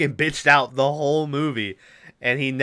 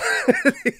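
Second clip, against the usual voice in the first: high laugh; 14 semitones higher, 3 dB louder than usual. Real, not acted. Laughing at own retelling.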